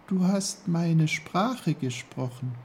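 high, small flower voice; high-pitched little character voice; little snowdrop. A narrator's reading. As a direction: speak as a tiny snowdrop. Snowdrop speaks.

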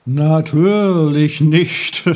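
laut, langsam, dröhnend; das Meer spricht. A narrator's reading. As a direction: wie eine gewaltige Meeresstimme. Das Meer antwortet Peter. (das Meer antwortet Peter laut und langsam)

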